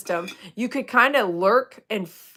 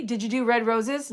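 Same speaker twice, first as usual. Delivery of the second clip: ordinary talk, nothing marked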